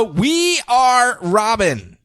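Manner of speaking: drawn-out grand announcement; announcer. Acted. Announcing title.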